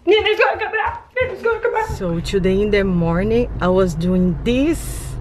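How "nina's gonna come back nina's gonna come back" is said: excited. Real, not acted. Excited about Nina coming home.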